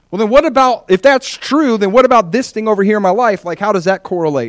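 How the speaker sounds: high, loud pleading questions; a believer pressing the Spirit, high and loud; eager questioner. Retold or imitated. Preacher voicing a believer questioning the Holy Spirit, high and loud.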